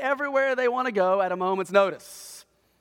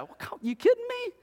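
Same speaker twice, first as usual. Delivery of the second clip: ordinary delivery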